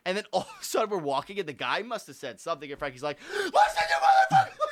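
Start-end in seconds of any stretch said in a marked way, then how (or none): 3.3-4.7 hammed-up high screaming; screaming at the man, exaggerated; mock scream. Retelling Frankie screaming at the man, hammed-up high yell. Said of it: "he's, like, screaming".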